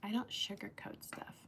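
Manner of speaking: whispered confession; a real whisper to the chat. Real, not acted. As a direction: breathe it as a secret aside. Whispered aside to viewers.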